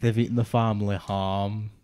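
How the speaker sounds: slowed mock-Scottish with strong R's; slow Scottish accent. Teasing a friend's attempt, slow Scottish accent. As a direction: drag it out in imitation Scottish. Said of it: "really slowly as a Scottish person".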